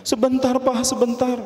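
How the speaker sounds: high, urgent plea to wait; anxious father, pitched right up; flustered pleading. Acted. Bride's father begs official to wait.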